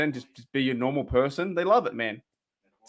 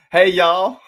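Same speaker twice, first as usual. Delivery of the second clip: loud, laughing Southern US greeting; Southern accent; 4 semitones higher, 9 dB louder than usual. Acted. Australian joking with viewers, loud mock Southern accent. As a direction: call out in a mock Texan accent. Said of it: "The Southern hospitality accent".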